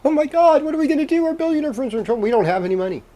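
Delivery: fake-frantic wail over "billionaire friends" in sarcasm; mock panic. Mocking billionaires for viewers with hammed-up sarcastic panic. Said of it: "It's silly".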